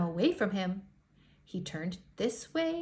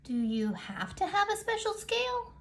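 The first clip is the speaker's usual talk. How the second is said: much higher small voice; little striped fish asking; 10 semitones higher than usual. Acted. Striped fish asks the others, in a much higher voice. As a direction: ask it small and unsure. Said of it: "the little striped fish asked".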